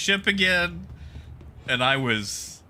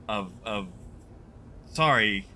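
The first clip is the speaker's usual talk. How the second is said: words stuck, dry mouth; 5 semitones lower than usual. Real, not acted. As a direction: talk through a dry mouth. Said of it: "my, my mouth is dry".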